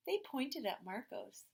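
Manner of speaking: usual speaking voice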